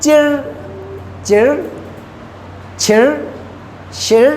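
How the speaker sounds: चिर, छिर, शिर each ending in a curled r; teacher's syllable drill; retroflex r drill. Shown as a demonstration. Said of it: "आर की sound आपको सुनाई दे रही है".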